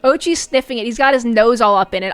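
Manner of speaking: normal talking voice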